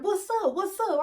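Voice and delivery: high-pitched, chirpy hail; eager greeting. Retold or imitated. Old flame greeting her outside the club, eager. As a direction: call out a quick high hello.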